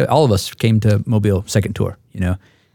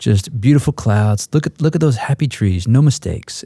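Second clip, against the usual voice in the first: Bob Ross; 2 semitones higher than usual. Retold or imitated. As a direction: coax it out like a TV painter admiring trees. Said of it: "like Bob Ross paints".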